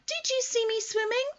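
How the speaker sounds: very high squeaky, thrilled voice; bubbling over with pride; excited squeak. Acted. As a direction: Roo telling everyone about his swim. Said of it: "squeaked Roo excitedly".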